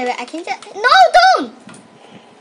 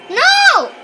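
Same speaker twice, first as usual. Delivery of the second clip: shout; 12 semitones higher, 9 dB louder than usual. Real, not acted. Begging friend not to.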